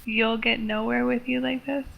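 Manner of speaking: soft, questioning reread of "You'll get nowhere with you like this"; quiet, baffled at her own writing; puzzled reread. Real, not acted. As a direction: mutter the line back, doubting its sense.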